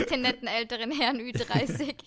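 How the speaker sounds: laughing. Real, not acted. Laughing mock quote.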